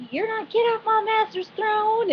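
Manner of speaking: high-pitched mock command; imitated telling-off in a high voice; acted indignant order. Acting out Pam's indignant order.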